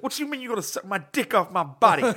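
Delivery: loud, high-pitched mock indignation; loud incredulous retort, imitated; imitating an outraged man. Outraged man's reply, acted out.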